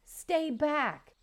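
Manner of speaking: a frightened goose's high squawk; mild goose squawk of alarm. Acted. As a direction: squawk it out, scrambling away. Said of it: "Bright Bill squawked".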